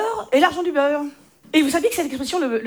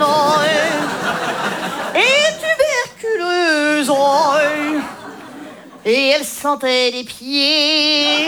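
slow hammed-up tearful lament; milk it like a melodrama; mock sob story, slow and heavy; mock tragic; 3 dB louder, slower than usual. Mock tragic tale, lines 1-10.

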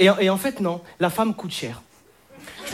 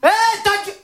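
sugary higher voice, giggling into the phone; sweet phone call to girlfriend; 5 semitones higher than usual. Shown as a demonstration. Boyfriend cooing to his girlfriend on the phone, giggly. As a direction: sweet-talk her with little laughs between lines. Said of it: "Je vais vous montrer".

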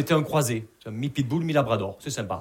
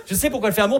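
high and loud, still building; repeated punchline; 9 semitones higher, 4 dB louder, faster than usual. Shown as a demonstration. Comic repeating the grandmother reveal, high and loud. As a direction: press the line again for effect.

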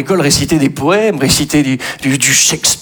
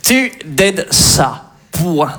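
slow, careful dictation; much slower than usual. Pretend. Teacher dictating song lyrics, slow and careful. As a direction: spell it out for the class.

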